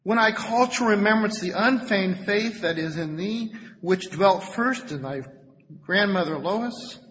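scripture reading. Acted. Preacher reads Paul's praise of Timothy, emphatic reading. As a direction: proclaim the verse, then ease down.

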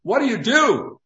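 roaring. A narrator's reading. Ricky roaring at Robinson demanding an answer, full roar. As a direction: roar the question at him.

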